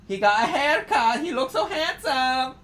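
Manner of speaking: soft, high coo; tender high cooing. Real, not acted. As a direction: gush softly over his haircut. Cooing about Eugene's haircut.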